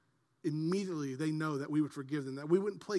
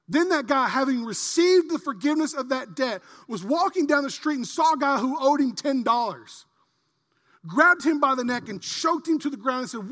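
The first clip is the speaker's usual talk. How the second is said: loud, high, driving parable telling; full-force preaching; 6 semitones higher, 9 dB louder than usual. Acted. Pastor telling of the unforgiving servant, loud preaching. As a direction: hammer the story home.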